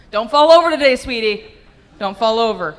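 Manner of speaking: loud, high sing-song mom warning; doting mom call. Pretend. Mom calling to her kid on the way to school, loud and doting.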